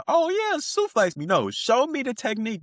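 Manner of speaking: high, sing-song mock gush; high, mocking squeal of a willing victim; mock eager reporter. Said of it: "You know I don't technically mean".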